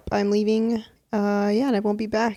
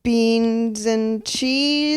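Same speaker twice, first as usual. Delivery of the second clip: slow sing-song; 2 semitones higher, much slower than usual. Acted. Playful slogan jingle.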